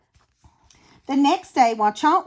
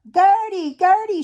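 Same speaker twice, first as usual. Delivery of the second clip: louder, higher call upward; 7 semitones higher, 4 dB louder than usual. A narrator's reading. Mother shark calling to the seagull overhead, calling out. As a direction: call across the water to the seagull. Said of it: "she called out".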